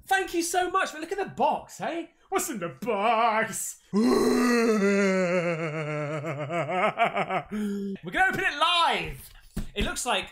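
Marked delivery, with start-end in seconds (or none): 2.3-3.8 slow, dragged-out film line; milk it like the thriller scene; slow movie-villain quote. Pretend. Vlogger quoting a film over his parcel, slow dramatic voice. 4.1-8.1 long helpless laugh into a near cry. Real, not acted. Vlogger cracking up at his own film joke, long laugh.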